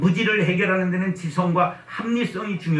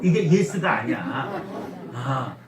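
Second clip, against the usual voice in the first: ordinary delivery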